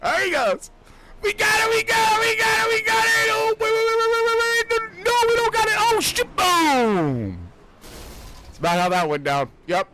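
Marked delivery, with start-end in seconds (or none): 0.0-7.4 real live yelling; frantic excited shouting. Real, not acted. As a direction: shout it as it unfolds. Watching replay, frantic calls.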